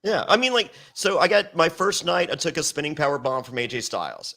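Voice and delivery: ordinary talk, nothing marked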